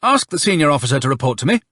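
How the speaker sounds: quick, high-pitched command; rapid officer's instruction; ordering. A narrator's reading. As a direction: give a brisk military order. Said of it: "the Colonel ordered".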